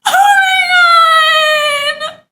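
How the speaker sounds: long, high, loud squeal; ecstatic shriek. Real, not acted. Streamer discovering a beloved gift, ecstatic shriek. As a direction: stretch it into one drawn-out shriek of delight. Discovers a favourite-film soap.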